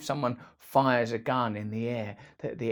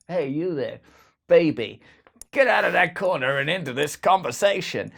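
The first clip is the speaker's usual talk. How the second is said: lightly louder cheery beckoning call; mild jolly host inviting a wallflower; a hint of a jovial host; 4 semitones higher, 7 dB louder than usual. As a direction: lightly call a shy person over. Calling someone into conversation.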